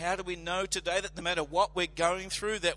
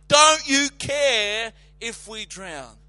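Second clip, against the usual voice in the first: loud, slow accusing cry; panicked fishermen yelling the accusation; accusing shout; 7 dB louder, slower than usual. Retold or imitated. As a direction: hurl the accusation at him. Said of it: "Accusing him, full of unbelief".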